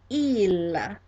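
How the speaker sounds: "il" said as French "eel", held out; model pronunciation. Shown as a demonstration. Teacher has class repeat the word for he, model pronunciation. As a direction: model French "il" with a clear final l. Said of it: "pronounce after me".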